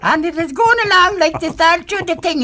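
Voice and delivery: put-on high squeaky boy voice; little high voice. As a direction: mimic the boy's squeaky character voice. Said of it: "a little high voice sort of thing".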